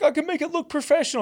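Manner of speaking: high, whiny mimic of him; mocking imitation. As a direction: parody his plea. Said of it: "He's like".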